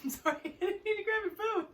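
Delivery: high, loud, giggly apology; laughing apology. Real, not acted. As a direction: apologise loudly through giggles, voice shooting up. Giggling apology for accidental grab.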